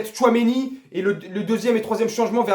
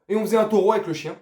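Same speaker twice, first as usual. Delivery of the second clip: usual speaking voice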